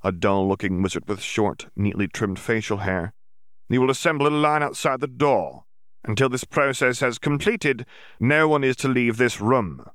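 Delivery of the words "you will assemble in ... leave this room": a commanding official's voice, a bit higher; stern Auror. Acted. Yaxley addressing the gathered students, commanding. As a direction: lay down the law to the room.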